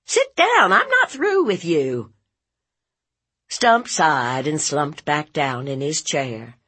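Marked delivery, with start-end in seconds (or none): 0.0-2.1 very high, loud order; angry commanding aunt. Acted. Aunt ordering departing nephew to sit, angry. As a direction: stop him with a sharp shout.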